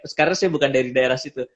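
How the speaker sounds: normal talking voice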